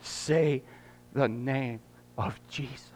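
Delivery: soft hushed whisper; whispered plea. Real, not acted. Pastor urging the congregation, whispering softly. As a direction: breathe the name gently to the church. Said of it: "What I want to say to you".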